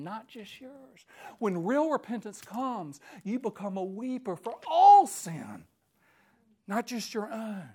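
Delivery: usual speaking voice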